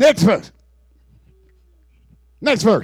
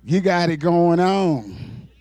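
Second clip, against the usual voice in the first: lower, slurred street flattery; lightly voiced smooth talker; mocking worldly flatterer; 8 semitones lower, slower than usual. Preacher mimicking the world flattering the rich man, low and smooth. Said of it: "the world told you".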